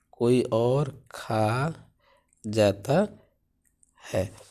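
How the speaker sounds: slow dictation of कोई और खा जाता है. Shown as a demonstration. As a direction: spell out the phrase for copying. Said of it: "repeated slowly for dictation".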